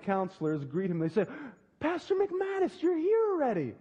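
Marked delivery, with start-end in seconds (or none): 1.8-3.7 surprised camp counselor. Retold or imitated. Camp counselors greet the pastor, high and surprised. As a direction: sing out a startled greeting, high up.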